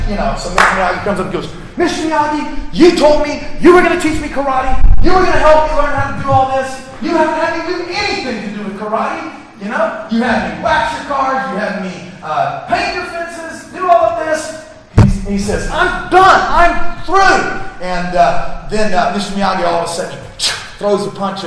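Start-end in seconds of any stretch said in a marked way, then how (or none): 1.8-14.7 high, pleading, exasperated rant; frustrated complaint. Retold or imitated. Daniel complaining to Mister Miyagi, high and exasperated. 15.7-17.6 high, loud, fed-up outburst; angry quitting. Retold or imitated. Daniel quitting on Mister Miyagi, loud and high.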